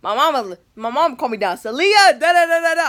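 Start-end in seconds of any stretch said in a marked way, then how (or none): 1.6-2.8 high, loud mock mom yell; imitating mom calling. Mom calls daughter down from upstairs, mock holler. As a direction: holler it like a mom summoning a kid.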